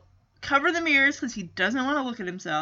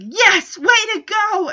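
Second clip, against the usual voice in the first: higher, louder cheer; excited cheering; 7 semitones higher, 4 dB louder than usual. Acted. Cherry claps for her crush at the meeting, cheering. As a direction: cheer them on enthusiastically. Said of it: "Cherry is all up in arms".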